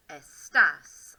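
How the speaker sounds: estás with a loud, clear TÁS; stressed final syllable. Shown as a demonstration. Teacher modelling Spanish for the class, loud stress on estás. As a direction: lean hard on the accented end of estás. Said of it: "I can hear where that accent goes".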